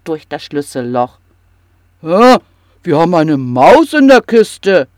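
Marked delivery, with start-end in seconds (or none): 2.0-4.9 loud, startled troll wife; old troll woman. Acted. Troll wife hears a mouse in the chest, loud and startled. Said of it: "sagte die Trollalte".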